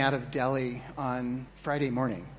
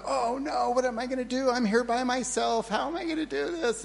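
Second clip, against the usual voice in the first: high, fretful mock panic, pitched up; retold panic; 8 semitones higher than usual. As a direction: replay the airport panic, half-hamming it. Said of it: "I'm just freaking out".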